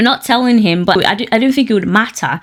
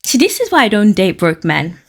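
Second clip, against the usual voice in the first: ordinary talk, nothing marked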